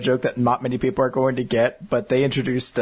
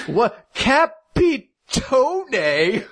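high, drawn-out puzzled "Capitone?"; a bewildered guy puzzling over a word; baffled mock confusion; 11 semitones higher, much slower than usual. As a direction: stretch it out, utterly lost. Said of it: "a dude who's just like".

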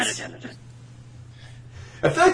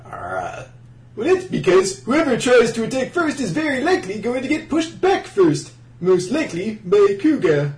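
ordinary talk

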